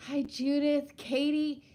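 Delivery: ordinary delivery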